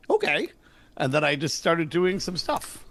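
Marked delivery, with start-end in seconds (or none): 0.1-0.5 squeaky high reluctant okay; a pinched, much higher okay. Retold or imitated. To the stand-ups, a squeaky high okay. Retold shrug of agreement.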